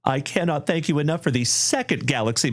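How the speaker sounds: usual speaking voice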